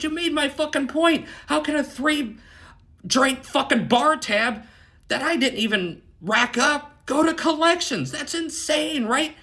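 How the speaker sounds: high-pitched indignant rant; acted-out incredulous protest; outraged disbelief. Acted outraged argument with manager.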